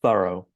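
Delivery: "Thorough" with a final "oh"; American pronunciation. Shown as a demonstration. Teacher repeating the American version, "Thorough" as "THUH-roh". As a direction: end "Thorough" on a clear letter O. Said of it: "like the English letter O".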